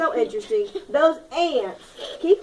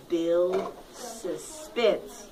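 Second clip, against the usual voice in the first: slow, soft, lower rule read; slow and hushed; 6 semitones lower, 8 dB quieter, much slower than usual. Shown as a demonstration. Teacher slowly and softly announces rule six to the class. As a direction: draw the words out quietly. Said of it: "announcing rule six".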